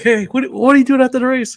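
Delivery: high-pitched, eager imitation; mock excitement. Retelling the excited friend's question, mock excitement. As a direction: play the excited pal, pitch jumping up. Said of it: "'cause he is excited".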